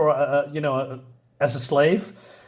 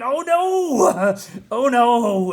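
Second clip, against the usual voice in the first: much higher, hammed-up shriek of fright; mock scream; 9 semitones higher, 3 dB louder, much slower than usual. Reteller voicing the woman who spots the attacker, mock terrified shriek. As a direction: squeal it like a comic-book victim. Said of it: "And she's screaming".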